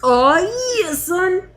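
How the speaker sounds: hammed-up dying groan; dramatic dying voice. Dubbing dying character.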